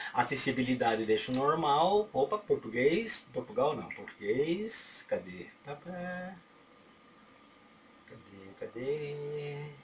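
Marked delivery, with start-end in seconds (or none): none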